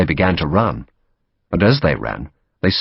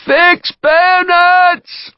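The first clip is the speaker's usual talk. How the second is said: very high, loud, long-drawn shout; shout passed along; 6 dB louder, much slower than usual. A narrator's reading. Order repeated down the line of redcoats, long drawn-out shout. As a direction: relay the order, stretching each word. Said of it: "was repeated down the line".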